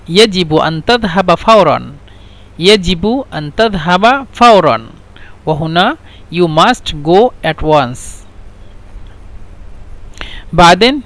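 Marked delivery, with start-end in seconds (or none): 6.3-8.3 slow. Shown as a demonstration. Teacher translating slowly for learners.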